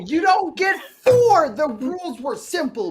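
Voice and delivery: slow, high mock-stern scolding. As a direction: lay down the law, hammed up. Mock stern rule enforcement.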